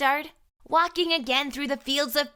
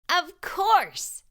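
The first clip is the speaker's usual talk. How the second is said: higher, bright aha moment; an excited storyteller's eureka; excited realization; 8 semitones higher than usual. A narrator's reading. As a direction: announce the discovery with delight.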